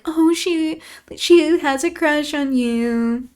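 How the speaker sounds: high, slow teasing singsong; mocking paraphrase. Reader mocks Isabella to Heathcliff, high teasing voice. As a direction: tease her rival openly. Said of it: "just humiliating Isabella".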